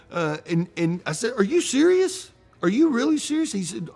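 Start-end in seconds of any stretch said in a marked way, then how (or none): 1.4-3.5 much higher and louder, thrilled disbelief; excited disbelief. Retold or imitated. Banker hearing his friend will open a bank, excited disbelief. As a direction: shoot up in delighted surprise.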